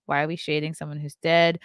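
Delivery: ordinary talk, nothing marked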